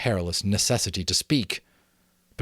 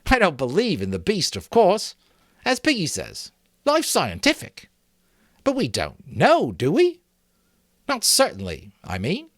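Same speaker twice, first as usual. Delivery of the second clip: higher, earnest schoolboy voice; boy Maurice reasoning; 10 semitones higher, 4 dB louder than usual. A narrator's reading. Maurice reasoning with the assembly, higher boyish voice. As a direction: talk it through like an anxious boy.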